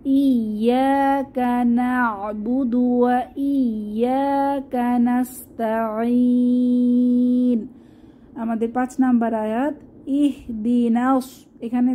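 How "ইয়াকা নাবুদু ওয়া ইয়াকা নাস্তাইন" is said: slow chanted verse to read along; tajweed reading; Quran recitation. Shown as a demonstration. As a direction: recite together slowly, stretch the end. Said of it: "তাহলে একসাথে পড়ি".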